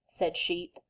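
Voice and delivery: ordinary delivery, nothing marked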